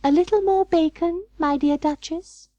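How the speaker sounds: high, sweet hostess voice; polite hostess voice. Acted.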